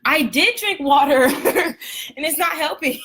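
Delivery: laughing through the words; giggling, slowed by laughter. Real, not acted. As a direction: laugh at yourself mid-sentence. Laughs at her own coughing.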